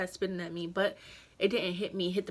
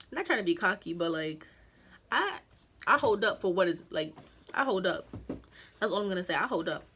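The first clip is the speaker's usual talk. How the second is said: ordinary talk, nothing marked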